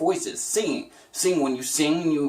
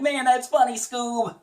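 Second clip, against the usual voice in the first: amused Shaggy to Scoob; lightly voiced Shaggy; 10 semitones higher than usual. Shaggy line after laugh.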